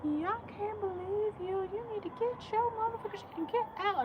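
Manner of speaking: high, fast put-on neighbor voice, mock yelling; mock angry neighbor. Retold neighbor yelling at someone.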